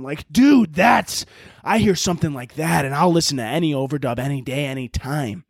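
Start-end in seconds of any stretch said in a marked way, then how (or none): none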